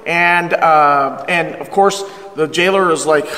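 usual speaking voice

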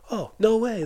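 high, loud mock astonishment; imitated amazement. Fellow mechanic reacting to the win, imitated amazement. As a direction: exclaim it like an amazed buddy. Acting out Dougie's amazed catchphrase.

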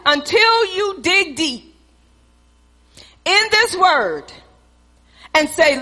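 ordinary talk, nothing marked

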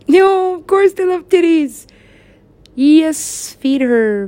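high, loud gleeful cry; excited outburst. Real, not acted. Excited about the baby feeding, high and loud. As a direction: burst out delighted.

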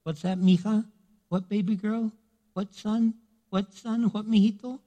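a hint of a fatherly God, gentle; tender God. God bending down to listen, tender. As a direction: lean in like a loving father. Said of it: "So God goes".

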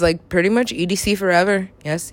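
normal talking voice

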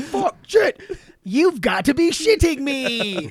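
high, slow, hammed-up curse; forced movie cursing. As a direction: overact a badly forced outburst from a film. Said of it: "that was a little forced".

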